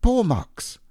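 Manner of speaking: high, eager little squeak; excited squeak. A narrator's reading. Piglet spotting paw marks, squeaking excitedly. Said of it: "He gave a little squeak of excitement".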